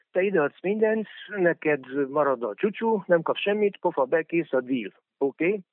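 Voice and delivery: boorish businessman impression. Parodying a bullying leader's style of deal-making, boorish and pushy. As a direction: play a pushy lout dictating terms. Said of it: "Körülbelül ez az ő stílusa".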